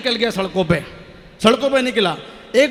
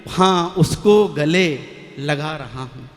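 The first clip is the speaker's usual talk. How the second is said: hesitant slow start; 6 semitones lower, slower than usual. Shown as a demonstration. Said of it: "चार पंक्ति में कहता हूं".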